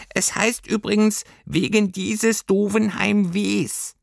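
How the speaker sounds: high, slow pedantic pipsqueak; squeaky know-it-all creature. A narrator's reading. Creature correcting the girl's grammar, squeaky and pedantic. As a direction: correct them primly in a tiny voice.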